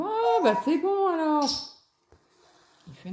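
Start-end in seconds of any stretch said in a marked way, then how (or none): 0.0-1.4 voix de collègue soulagé, beaucoup plus aiguë et forte; collègue imité. Retold or imitated. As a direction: imite le collègue, l'air soulagé. (imitation du collègue soulagé, aiguë et forte)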